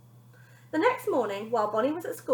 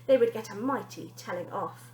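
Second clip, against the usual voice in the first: plain reading voice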